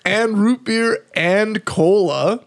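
slow, drawn-out listing in disbelief; half-mock outrage at the mix. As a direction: spell out each flavor slowly, aghast. Listing flavors incredulously.

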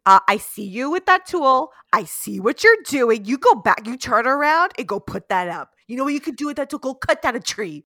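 loud, firm telling-off; mock stern dressing-down; scolding mother. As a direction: order them away with sass. Voices labouring woman scolding doctors.

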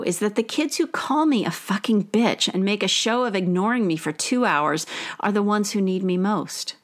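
normal talking voice

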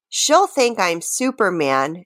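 ordinary talk

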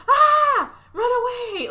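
high, loud cartoonish alarm; mock panicked scream. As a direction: imitate people fleeing in panic. Imitates the others fleeing.

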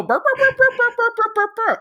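repeated high yelping noises, a dog hollering; high mock yelps. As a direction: squeal out quick yelps like a kicked dog. Mocking hit dogs hollering.

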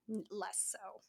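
losing voice, words barely come out; voice giving out. Real, not acted. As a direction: voice fading and cracking. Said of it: "I'm losing my voice now too".

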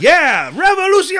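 very high, mock-fervent cheer; crowd cheer. Host imitating everybody answering the coup speech, high excited cheer. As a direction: whoop like a whole roused crowd.

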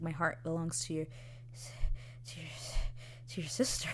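hushed, faltering imitation; halting confession. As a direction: keep stalling before the hardest words. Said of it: "he can't even say it out loud".

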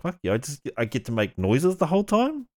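usual speaking voice

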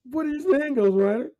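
high, hammed-up question; mock TV sidekick. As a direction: squeak it out like a corny kids' show. Parodying a TV scene.

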